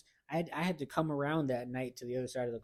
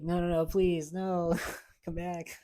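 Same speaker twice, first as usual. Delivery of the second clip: higher, louder, drawn-out hammed-up begging with a laugh; mock pleading; 5 semitones higher, 5 dB louder than usual. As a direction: overplay the desperate plea, half laughing. Retold begging ex not to leave.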